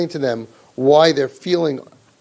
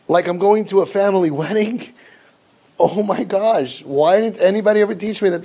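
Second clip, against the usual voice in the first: higher, gushing fake wonder; mock amazement; 3 semitones higher than usual. A child sarcastically amazed at the parent's obvious tips, higher. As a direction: act amazed at the obvious, full of irony.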